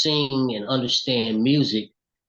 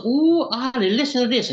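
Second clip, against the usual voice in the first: put-on old lady voice; 11 semitones higher, 4 dB louder than usual. Mother excited on phone.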